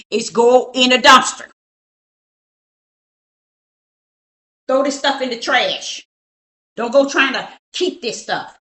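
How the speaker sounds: rising from firm to loud insistence; building firm orders. Retold or imitated. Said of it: "That's what I told 'em".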